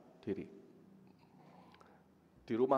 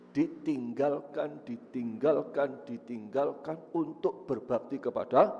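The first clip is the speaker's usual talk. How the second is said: each "ditinggalkan" drawn out slowly and louder; weighty, slow repeated "ditinggalkan"; slow emphatic repetition; 4 dB louder, slower than usual. Acted. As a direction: lean hard and slow on every "ditinggalkan". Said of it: "repeated for emphasis".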